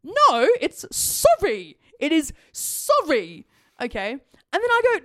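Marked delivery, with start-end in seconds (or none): 0.0-3.5 SORRY drawn out slowly in full; emphatic correction. Retold or imitated. Woman corrects a curt apology, SORRY slow and emphatic. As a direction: spell out SORRY as a lesson, both syllables stretched. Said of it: "say the full fucking word".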